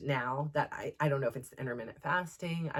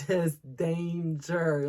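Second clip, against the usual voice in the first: laughing; 4 dB louder, much slower than usual. Retold or imitated. Son's warning, quoted playfully.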